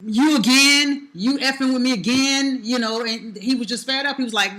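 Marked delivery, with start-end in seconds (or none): 0.0-2.6 slow, louder and higher, daring the cop; fed-up confrontation. Retold or imitated. Man hassled again by a familiar cop, retold fed-up challenge. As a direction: drag it out, sick of being hassled. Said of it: "So he's basically like".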